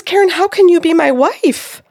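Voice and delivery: high, loud, incredulous question; astonished disbelief. Retold or imitated. Client questioning the medium, loudly incredulous. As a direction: cry out in total bafflement.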